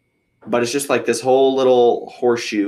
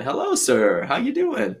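imitating a cheery officer; hammed-up chipper greeting, soaring at the end; 10 semitones higher, slower than usual. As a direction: lift way up high on the friendly question.

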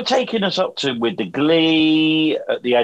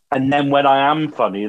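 ordinary talk, nothing marked

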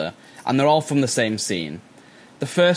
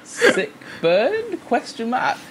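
mock confused; 12 semitones higher, slower than usual. Mock confused soldier response.

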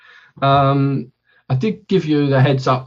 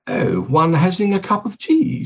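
mock-posh English accent; really posh English; 3 semitones higher than usual. Shown as a demonstration. Mimicking Americans doing English on TV, exaggerated posh accent. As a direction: put on a posh English tea-time voice. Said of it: "like a really posh".

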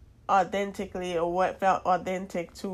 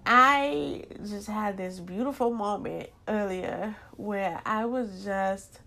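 usual speaking voice

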